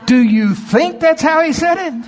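sudden high-pitched preaching outburst; indignant shout. Real, not acted. Preacher challenging the congregation's mild Jesus, indignant shout.

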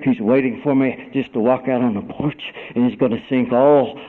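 ordinary talk, nothing marked

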